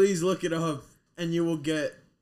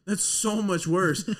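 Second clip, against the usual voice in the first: ordinary talk, nothing marked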